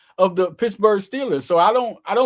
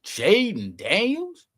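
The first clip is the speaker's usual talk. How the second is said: even slower, higher rising disbelief; drawn-out amazed echo; more incredulous; 5 semitones higher, much slower than usual. Real, not acted. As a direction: savor the name in awe.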